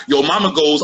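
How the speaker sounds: ordinary talk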